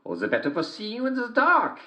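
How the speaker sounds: very high sing-song falsetto as the wolf; a wolf pretending to be sweet, sung up high; wolf in disguise. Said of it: "said the wolf".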